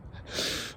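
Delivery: quiet breathy laugh. Real, not acted. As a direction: chuckle under your breath. After scaring the cat.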